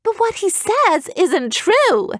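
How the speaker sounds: put-on high, slow girl's outcry; shouting girl. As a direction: burst out in protest, high and slow. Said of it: "Evie shouted".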